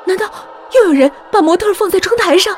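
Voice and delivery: high, loud, rushed question; alarmed questioning. A narrator's reading. Frightened woman's startled guess.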